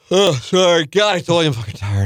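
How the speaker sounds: ordinary talk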